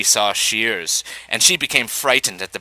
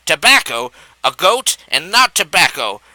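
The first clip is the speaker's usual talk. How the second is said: crying out; 6 semitones higher than usual. A narrator's reading. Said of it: "cried Miss Fox".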